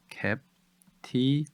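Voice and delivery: "cap-ti-vi-ty" very slow, each syllable apart. Shown as a demonstration. Teacher breaks "captivity" down for learners, syllable by syllable. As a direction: stretch "captivity" into separated syllables. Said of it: "음절은요".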